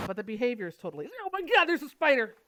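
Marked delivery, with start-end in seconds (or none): 1.2-2.3 high, loud startled cry; startled panic. Real, not acted. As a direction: shriek at the spider. Sees a spider.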